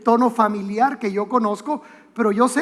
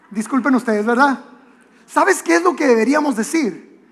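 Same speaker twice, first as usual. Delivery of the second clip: usual speaking voice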